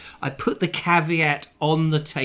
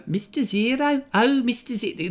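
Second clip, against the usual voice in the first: higher, badly faked Birmingham accent; Birmingham accent attempt; 9 semitones higher than usual. Getting into character by catchphrase, mock Birmingham accent. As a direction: warm up into a mock Brummie accent. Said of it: "in order to get into a Birmingham accent".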